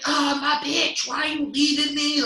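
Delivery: very high, slow mock wail; drunk screaming. Drunk guy screaming after his departing girlfriend, high and drawn out. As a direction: ham up the drunk's pleading scream. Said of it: "Like, screaming at her".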